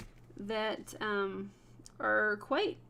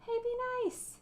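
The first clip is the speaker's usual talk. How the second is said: lightly voiced husband, pitched higher; a quick higher mock of her husband; husband quote; 13 semitones higher than usual. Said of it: "Even when my husband goes to".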